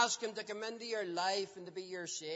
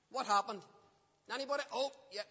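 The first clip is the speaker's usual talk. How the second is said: ordinary delivery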